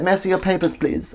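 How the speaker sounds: put-on German accent, starting high and dropping. German paratrooper asks to see papers, German accent. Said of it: "my German accent is a high pitch like that".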